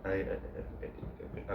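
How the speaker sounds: hushed, halting stutter on one word; acted stammer. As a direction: get stuck on the word, barely audible. Character recalls stutter, stammers on I.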